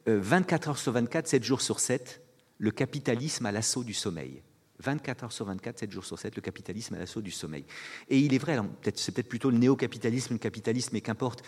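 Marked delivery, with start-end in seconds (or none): 4.8-7.6 the title again, much softer; soft, musing repetition of the title; quiet repeat. Shown as a demonstration. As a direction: let it sink in, hushed. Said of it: "un livre qui s'appelle".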